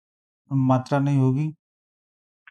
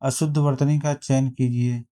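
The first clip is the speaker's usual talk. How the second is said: usual speaking voice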